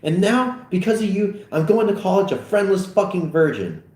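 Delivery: high, resentful outburst; heated, emphatic blame; bitter tirade. Acted. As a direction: let the resentment pour out, high. Acted quarrel, bitter tirade.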